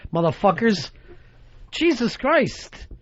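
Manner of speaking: normal talking voice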